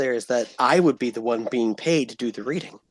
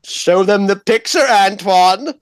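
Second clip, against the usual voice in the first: calling out; 6 semitones higher, 3 dB louder than usual. Real, not acted. Calling to Antoine repeatedly.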